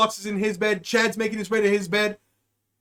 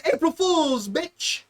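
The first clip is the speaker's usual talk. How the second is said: prank reveal; 6 semitones higher, slower than usual. Retold or imitated. Acting out the prank's gleeful reveal.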